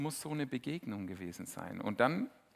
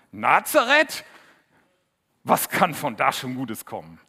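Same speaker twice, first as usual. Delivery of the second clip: scornful doubt; 3 semitones higher, 16 dB louder than usual. Retold or imitated. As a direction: scoff at the town as if nothing good could come from it. Nathanael zweifelt an Nazareth. (laut und höhnisch, Nathanael spottet über Nazareth)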